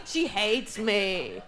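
deadpan slow repeat; slow, low repeat. Shown as a demonstration. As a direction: let it sink in, slowly and down low.

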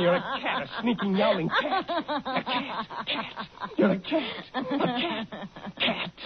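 mocking laughter. Husband keeps taunting, laughing.

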